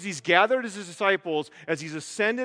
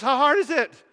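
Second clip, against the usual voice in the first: exasperated Zwingli; 7 semitones higher, 7 dB louder than usual. Retold or imitated. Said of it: "Zwingli goes".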